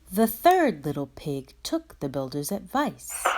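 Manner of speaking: ordinary delivery, nothing marked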